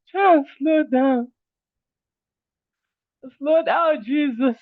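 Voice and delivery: high, slow, amused read of the chat; chuckling at the complaint. Retold or imitated. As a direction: read it out half laughing in disbelief.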